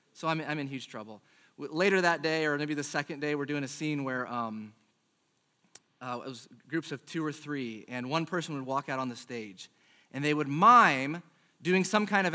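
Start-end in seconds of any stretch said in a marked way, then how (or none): none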